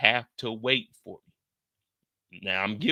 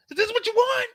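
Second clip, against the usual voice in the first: much higher, defiant calling; defiant Francis; 3 dB louder than usual. Retold or imitated. Francis at the roof edge calling the sniper, high defiant call.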